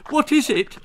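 high-pitched mother calling out; a mild shout, pitched high; mock shout. A narrator's reading. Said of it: "shouted Helen's mother".

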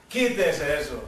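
low-pitched, contained order; divine command. Retold or imitated. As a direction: give the order low, as the Lord. Primera orden de Dios, grave. (orden divina en tono grave)

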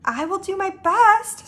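unhurried, lightly voiced assurance; patient. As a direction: answer with measured patience. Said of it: "Sophie said patiently".

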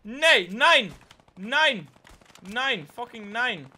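high, loud repeated protest; frustrated yell. Real, not acted. Getting shot down, yelling in protest. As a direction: yell no at the game.

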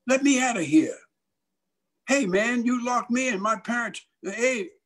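high-pitched alarmed calling out; trapped boy protesting. Retold or imitated. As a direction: call for help through a locked door. Locked-in boy demands release.

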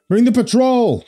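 cried out high and loud; urgent cry. Acted. Villager crying out across the harbour, high loud shout. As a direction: call out for help over the fighting. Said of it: "someone cried".

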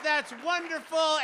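ordinary talk

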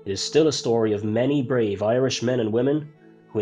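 plain reading voice

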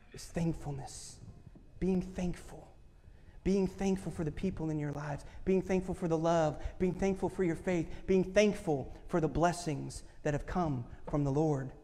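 low, hushed thankful exhortation; soft reflective preaching. Real, not acted. Preacher urging congregation to be thankful, hushed. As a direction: draw them in quietly.

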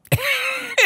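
squealing, high-pitched laugh; high laughing fit. Real, not acted. Storyteller cracking up at the punchline, high laughter. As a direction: crack up at your own story.

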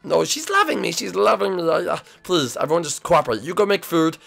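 high-pitched frantic begging; pleading. Acted. Son begging family not to ruin his date, high and pleading. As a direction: beg them urgently, voice pushed up. Son begs everyone to cooperate.

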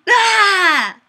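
high, loud hammed-up scream; over-the-top scream reading the comment; mock scream. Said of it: "And a lot more screaming".

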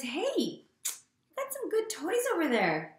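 light, chirpy invite to a playmate; storybook pal asking to join in; friendly invitation. Acted. Trevor voiced inviting Pig to play.

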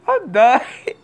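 high wheezy laugh-cry; dying laughing. Real, not acted. Vlogger cracking up at own story, wheezy laughing. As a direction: gasp it out through laughter. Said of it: "laughing like I'm doing right now".

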